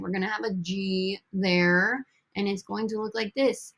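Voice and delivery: ordinary talk, nothing marked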